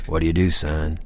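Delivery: low voice of a big bar regular; deep, older man's voice; gruff old biker. Retold or imitated. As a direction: drop down deep, like a Santa-biker stranger. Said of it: "Compared to his voice".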